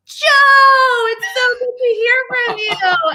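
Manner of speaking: high, drawn-out, loud squeal of delight; gushing retold greeting. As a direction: cry out the name, overjoyed to hear from a long-lost friend. Retold overjoyed reply to a friend.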